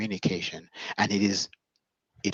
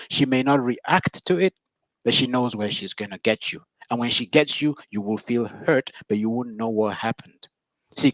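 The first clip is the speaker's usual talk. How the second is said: normal talking voice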